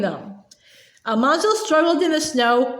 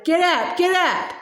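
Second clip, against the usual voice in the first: raised pitch, no extra volume; restrained narrated shout; 5 semitones higher than usual. A narrator's reading. Frustrated boy to steers standing still, restrained shout. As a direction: call the command twice, keeping it contained. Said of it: "He shouted".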